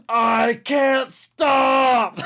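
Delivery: high, slow drawn-out scream. Retold or imitated. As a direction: scream it, zero to sixty. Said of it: "He just screams in the middle".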